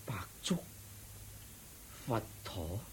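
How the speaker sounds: barely audible confusion; hushed bewildered whisper. Acted. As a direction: whisper it, lost. (迷惘噉細聲耳語)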